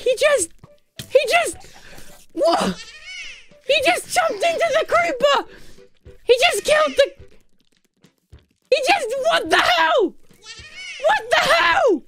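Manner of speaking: high, broken exclamations with a laugh; stunned disbelief. Real, not acted. Streamer reacting to her pet killing the creeper, stunned. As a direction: sputter in shock.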